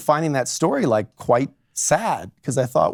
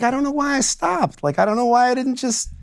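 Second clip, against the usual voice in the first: higher, lightly voiced wife remembering; lightly voiced client; 12 semitones higher than usual. Lawyer retells a client describing her marriage, higher voice. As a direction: speak as the wife, a little higher. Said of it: "she's like".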